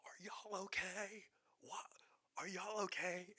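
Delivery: high, slow whispered concern; imitating worried neighbor. Neighbors checking on the screaming, high worried voice. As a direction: call out worried from far off. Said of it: "the neighbors come out, and they're like".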